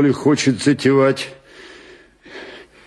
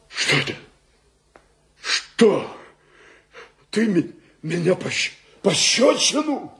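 stammering, higher-pitched disbelief; stunned outrage; 5 semitones higher, 4 dB quieter than usual. Acted. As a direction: stammer in shock at a slap. Stunned at being slapped. (ошеломлённо, запинаясь, высоким голосом)

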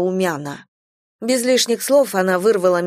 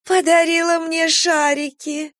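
high, slow, moved gushing; touched; 10 semitones higher than usual. Acted. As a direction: melt over the little gift. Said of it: "Растрогалась тётя".

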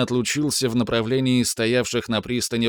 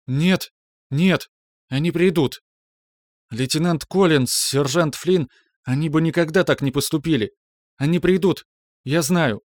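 convincing herself; 6 semitones higher than usual. Acted. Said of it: "едва сдерживая досаду, словно пытаясь убедить саму себя".

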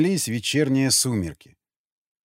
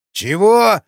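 put-on deaf old man; 7 semitones higher, 8 dB louder than usual. A narrator's reading. Deaf guard again.

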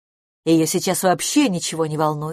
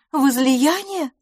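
high, drawn-out anxious query; worried questioning; 9 semitones higher, 3 dB louder, much slower than usual. A narrator's reading. Miss LaFosse suspects her friend of drinking, worried. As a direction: stretch the word out high, in concern. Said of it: "вопросила мисс Лафос обеспокоенно".

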